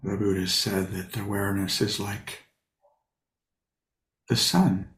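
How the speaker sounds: unhurried, leave long pauses between short phrases; guided meditation. Real, not acted. Teacher settles sitters into posture and breath, guided meditation.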